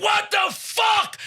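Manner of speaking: high, stretched-out shout of disbelief; outraged yell. Retold or imitated. Contestant jumps out of his seat, yelling in outrage. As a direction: explode in drawn-out outrage. Retold outburst at trivia.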